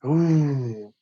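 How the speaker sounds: normal talking voice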